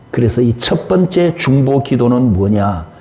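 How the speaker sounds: ordinary talk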